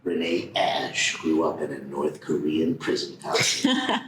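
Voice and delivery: deep, slow trailer narrator; movie trailer voice. Shown as a demonstration. Asked to narrate her life, deep trailer voice. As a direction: narrate like an epic film trailer, pitched low. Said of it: "narration of my life in that voice".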